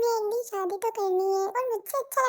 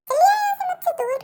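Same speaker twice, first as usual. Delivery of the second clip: angry; 8 semitones higher than usual. Acted. Girl driving him away.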